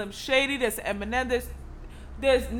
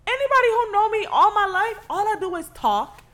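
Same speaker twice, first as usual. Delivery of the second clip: mock rant; 9 semitones higher, 3 dB louder than usual. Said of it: "'Cause I'm like".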